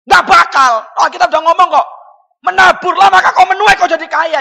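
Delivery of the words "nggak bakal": loud, high dismissal; emphatic refusal. Real, not acted. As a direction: shout it down forcefully. Loud rebuttal of quoted bargain.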